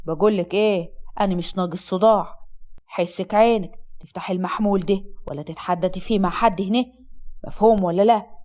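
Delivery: low, stern male warning; domineering husband. Acted. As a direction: forbid her in a deep, threatening voice.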